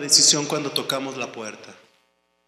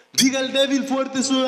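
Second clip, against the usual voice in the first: rally the flock with a raised call; preaching call; 8 semitones higher than usual. Acted.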